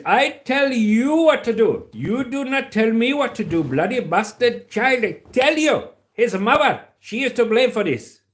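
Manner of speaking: loud, high-pitched Indian dad telling off his son; Indian accent. Acted. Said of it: "the Indian guy there, is, his accent was amazing".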